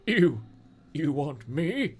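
incredulous, lifting question; startled and drawn-out; rising surprise. A narrator's reading. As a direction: voice climbs in disbelief. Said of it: "Brendan's voice rose in surprise".

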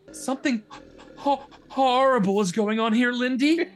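tearful stammer. A narrator's reading. Chris pleading with her sister, tearful stammer. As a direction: stutter it out, hammed-up tears. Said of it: "Chris stammered through her tears".

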